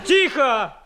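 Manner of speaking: sharp repeated call for silence; commanding hush. Acted. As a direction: cut through the uproar.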